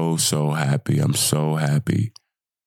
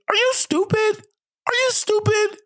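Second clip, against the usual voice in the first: much higher, screeching with joy; excited yell. Real, not acted. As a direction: shriek it out in pure celebration. Hyped after World Series win.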